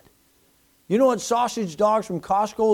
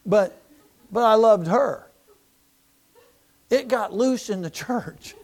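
ordinary talk

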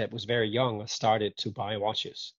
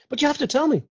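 pleading; 7 dB louder, faster than usual. Acted. Acted pleading for the secret.

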